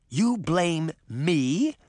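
slow, affronted disbelief; haughty outrage, stretched word by word; indignant shout. A narrator's reading. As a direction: draw it out, deeply offended. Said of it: "Moth shouted".

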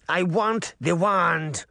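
put-on high, slow demanding witch, Russian accent. A narrator's reading. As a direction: crone claiming her price in a Russian accent. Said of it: "in a thick Russian accent".

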